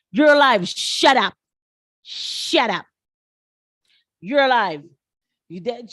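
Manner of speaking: loud mock heckling; imitated yelling. Crowd yelling at this woman, mock yelling. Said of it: "people are yelling at this woman".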